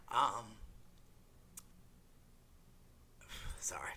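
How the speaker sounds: real tears catching in the throat; fighting back tears, voice breaking; choked up and apologizing. Real, not acted. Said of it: "a little choked up, sorry".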